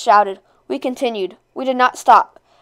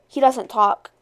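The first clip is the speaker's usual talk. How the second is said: usual speaking voice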